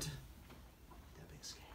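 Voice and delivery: plain reading voice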